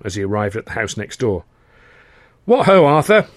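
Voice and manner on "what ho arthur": high, loud hearty greeting; bright hello from an upper-class lad; cheery young toff. Acted. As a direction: call out like a chirpy young gent. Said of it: "said Freddie".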